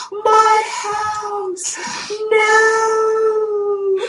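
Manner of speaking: very high, drawn-out mock wail; mock scream. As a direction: imitate screaming in despair over a lost house. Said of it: "I was screaming".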